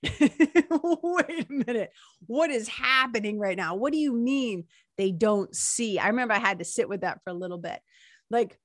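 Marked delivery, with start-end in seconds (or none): 0.0-6.0 pitched up, drawn-out disbelief; retelling her astonishment at the line; high, slow astonished voice. Retold or imitated. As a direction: stretch it out in wide-eyed shock. Retold amazement at reading line.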